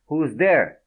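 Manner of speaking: loud, high startled call; startled shout. Acted. Fredo startled by the flush, shouting out. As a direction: jump and shout it out.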